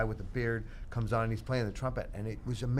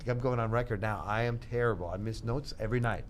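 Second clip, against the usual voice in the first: normal talking voice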